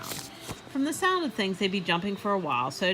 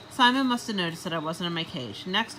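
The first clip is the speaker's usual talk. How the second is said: usual speaking voice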